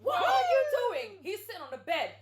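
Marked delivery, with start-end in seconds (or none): 0.0-1.2 high, loud, drawn-out outburst; outraged shout. Retold or imitated. Retelling a girl walking in on them, outraged shout. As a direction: shriek it slowly, acting the intruder.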